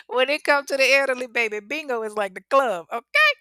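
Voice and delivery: loud, high sassy aside to the audience. Real, not acted. Telling her viewers how the elderly see bingo, sassy and loud. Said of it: "That's like the club to them".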